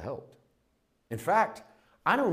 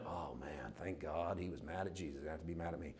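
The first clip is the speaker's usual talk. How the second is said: much softer, lower relieved murmur; relieved inner voice; 4 semitones lower, 12 dB quieter than usual. Retold or imitated. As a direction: say it to yourself in quiet relief. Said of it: "I went".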